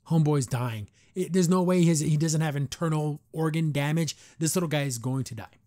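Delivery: ordinary talk, nothing marked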